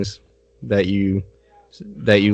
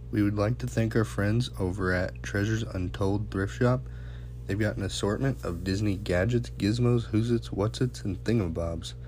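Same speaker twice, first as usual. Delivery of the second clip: softer, measured sponsor read; 2 semitones lower, 13 dB quieter than usual. Acted. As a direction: read the ads calmly. Reads sponsor ads.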